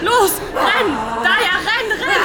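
hoch und laut, voller Panik; frantic shout. Acted. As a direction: schrei sie an, sofort wegzurennen. Leslie treibt Daya zur Flucht. (Leslie schreit Daya an, zu rennen: panisch)

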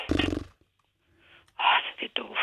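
breathy whisper, barely voiced; whispered self-mockery. Real, not acted. Realizing the mistake, she whispers to herself, softly. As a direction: whisper it, embarrassed at yourself.

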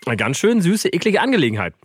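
ordinary talk, nothing marked

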